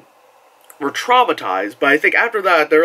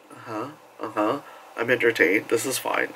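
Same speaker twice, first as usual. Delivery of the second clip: low, much softer, deadpan; bored indifference; 5 semitones lower, 9 dB quieter than usual. Retold or imitated. Jaded fan, low soft bored mumble. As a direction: mumble it unimpressed. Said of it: "kinda like".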